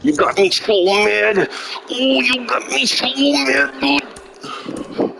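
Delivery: high, slow, whiny "so mad"; sarcastic pretend upset, whining it out; mock whiny voice. Mocking the troll with a high, slow whine.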